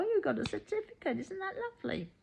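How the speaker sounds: high, sneering fake admiration; mock them as if the certificate were cute; sarcastic mock praise. Mimicking people who belittle her certificate, sarcastic and high.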